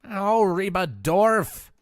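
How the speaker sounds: slow, loud, high whine; whiny voice. Acted. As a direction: drag it out like a whiny brat. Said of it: "the whiny little bitch ones".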